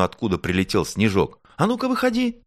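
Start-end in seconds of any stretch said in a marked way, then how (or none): 1.6-2.4 very high calling-out voice; high, commanding call. A narrator's reading. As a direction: order someone out with a high cry. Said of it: "крикнула в ту сторону".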